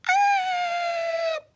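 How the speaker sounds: loud, very high falling-chair squeal. A narrator's reading. Goldilocks rocking until it breaks, high squeal. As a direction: squeal as the chair tips over.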